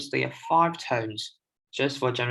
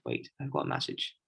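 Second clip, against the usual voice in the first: whispered aside; 13 semitones lower, 8 dB quieter than usual. Real, not acted. Aside mid-lesson, reading a message.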